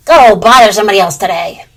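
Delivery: loud, unbothered brush-off; firm dismissal. Acted. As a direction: wave him off firmly. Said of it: "replied Blue Jay coolly".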